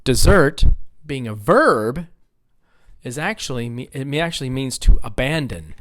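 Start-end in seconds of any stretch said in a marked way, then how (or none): 0.0-0.6 Dessert punched loud on ZERT; Dessert said as di-ZERT for a class; second-syllable stress. Shown as a demonstration. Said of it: "it's on the second syllable".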